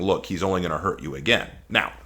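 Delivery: usual speaking voice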